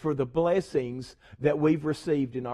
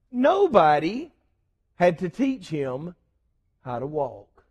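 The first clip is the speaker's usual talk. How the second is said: slow it down and let it sink in; dramatic pauses. Real, not acted. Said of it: "dramatic pauses, fading".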